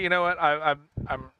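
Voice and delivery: normal talking voice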